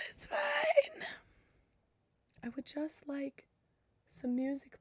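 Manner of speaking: a high, whiny sigh then a fake sob on fine; mock whimper. Streamer whines to chat about dying headphones in a high mock-crying voice.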